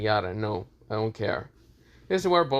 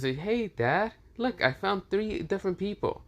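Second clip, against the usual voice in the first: a lightly voiced excited little kid, pitched higher; innocent child; 9 semitones higher than usual. Issei, proudly showing his new friends to Kakashi, as a lightly voiced innocent child. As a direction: chirp it out like an eager young boy showing his dad. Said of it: "acting like an innocent child".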